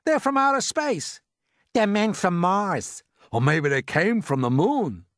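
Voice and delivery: high, urgent cries from onlookers; excited crowd shouts. A narrator's reading. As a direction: call out wild guesses in alarm. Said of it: "they shouted".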